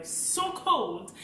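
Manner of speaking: ordinary delivery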